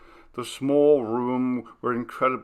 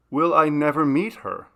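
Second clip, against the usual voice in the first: wonderingly; 5 semitones higher, 6 dB louder than usual. Acted. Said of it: "Margaret asked wonderingly".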